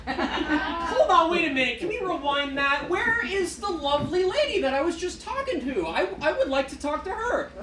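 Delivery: mock-frantic plea to the box; flustered disbelief. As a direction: beg to rewind, half laughing. Demanding the recorded lady back.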